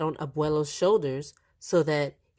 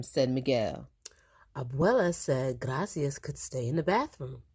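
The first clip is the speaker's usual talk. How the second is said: ordinary delivery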